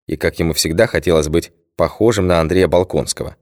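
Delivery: plain reading voice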